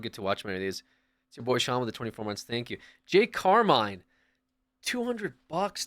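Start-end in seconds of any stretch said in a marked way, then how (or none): 3.0-5.8 amazed again. Real, not acted. Rereading the donation amazed.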